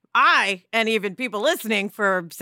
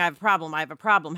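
lower, sulky complaining; 6 semitones lower, much faster than usual. Retold or imitated. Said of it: "vibrate lower".

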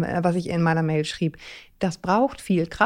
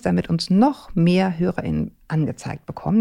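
ordinary talk, nothing marked

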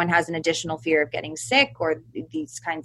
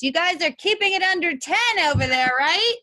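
calling out; 11 semitones higher than usual. Retold or imitated. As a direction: call across the yard. Calling over fence to neighbors.

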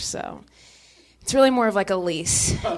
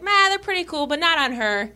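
higher, mock-casual boyfriend reply; dismissive boyfriend; 7 semitones higher than usual. Boyfriend replying to a photo of pants, imitated as dismissive. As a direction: imitate him shrugging it off, starting high. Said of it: "He was like".

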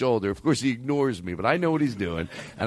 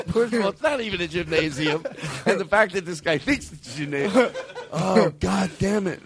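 higher voice breaking into laughter; real amusement; 4 semitones higher than usual. Real, not acted. Host cracking up over comedian's bit, laughing delightedly. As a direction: talk through the laughs.

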